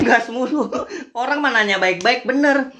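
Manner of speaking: high-pitched, tickled repeat after a laugh; amused agreement. Real, not acted. Creator repeating a netizen's comment he agrees with, amused and emphatic. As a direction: echo it emphatically, voice lifted.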